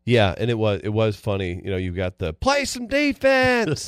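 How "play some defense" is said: high, slow Southern drawl yelling; Southern accent heckle; Southern fan accent. Retold or imitated. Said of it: "real Southern accent".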